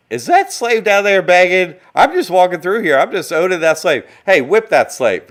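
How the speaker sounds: high, loud, sneering master voice; lazy cruel slave owner talking down to a slave, mocked; mocking slave owner. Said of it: "And they're like".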